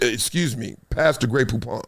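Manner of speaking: low, quiet, prim mock-posh voice; hushed snooty aside; posh Duncan impression. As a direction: play Duncan as a fancy gentleman asking for mustard. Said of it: "he don't even talk like that".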